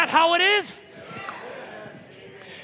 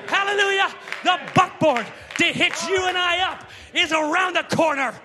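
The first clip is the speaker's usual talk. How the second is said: fiery preaching; 4 semitones higher than usual. Acted. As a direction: stir the congregation up. Full-force preaching.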